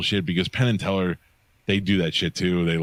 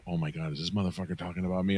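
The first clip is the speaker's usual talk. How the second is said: panicked inner whisper; 8 dB quieter, faster than usual. Retold or imitated. Inner panic, hiding in audience.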